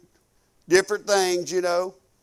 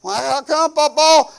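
put-on little girl's voice; 5 semitones higher, 4 dB louder than usual. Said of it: "daughter asks".

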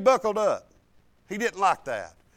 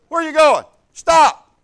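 calling out sharply; 5 semitones higher, 12 dB louder, slower than usual. Retold or imitated. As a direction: shout it, louder and higher. Grandpa calling the boy back.

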